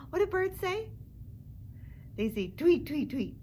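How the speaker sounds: bright tweet to delight toddlers; excited. Pretend. Excited question to children.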